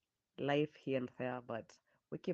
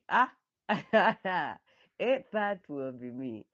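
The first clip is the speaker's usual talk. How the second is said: laughing; 4 semitones higher, 11 dB louder than usual. Real, not acted. Picturing herself crying at graduation.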